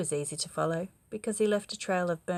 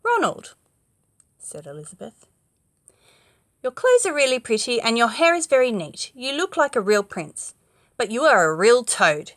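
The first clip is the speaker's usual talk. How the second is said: cutting retort; 4 semitones higher, 9 dB louder than usual. Acted. Princess retorts.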